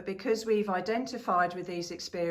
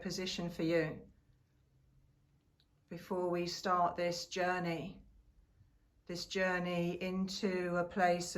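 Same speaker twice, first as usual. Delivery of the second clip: calm, unhurried meditation guide with long pauses; guided meditation. Real, not acted. Settling listeners into a comfortable seated position, guided meditation voice. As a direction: ease listeners gently into stillness, pausing between phrases.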